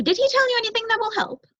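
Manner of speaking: high, fast, fretful bird voice; anxious young crow. Acted. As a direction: hurry like a nervous youngster. Crow eager question.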